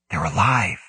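ordinary delivery

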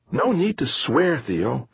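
high, prissy little-sister reproach; prim scolding. Acted. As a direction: tut at him primly. Sister scolds swearing brother.

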